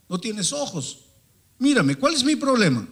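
loud, high-pitched indignant challenge; angry retort. Retold or imitated. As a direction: act out a bitter man lashing back. El joven amargado increpa al pastor. (réplica airada, fuerte y aguda)

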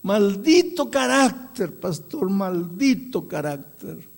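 drawn-out, high mock wail, easing off; acted anguish, loud then fading; imitated crying lament. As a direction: lament it slowly like a remorseful old man. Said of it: "comenzó a llorar y dice".

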